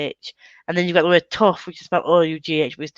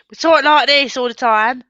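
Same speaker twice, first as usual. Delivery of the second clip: put-on louder, higher caricature British accent; 4 semitones higher, 5 dB louder than usual. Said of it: "I think Americans think".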